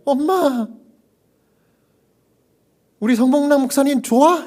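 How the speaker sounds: an overjoyed daughter begging her mom to answer; tearful eager daughter. Retold or imitated. Daughter asks dying mother if she likes the pastor, high and eager.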